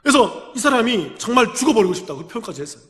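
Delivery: normal talking voice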